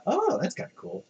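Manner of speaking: high, lightly surprised; light quoted surprise; impressed. Retold or imitated. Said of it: "And I was like".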